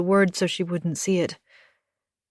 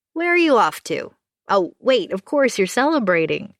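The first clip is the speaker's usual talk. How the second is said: higher, brighter neighbour June, friendly; cheerful June voice; 4 semitones higher, 5 dB louder than usual. Acted. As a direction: lift the voice up for chatty June. Neighbour June greets Anna.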